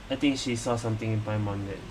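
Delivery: normal talking voice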